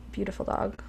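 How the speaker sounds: tender quiet echo of "Beautiful dog"; soft heartfelt repeat. Shown as a demonstration. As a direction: murmur "Beautiful dog" again with quiet admiration. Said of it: "I wanna say that".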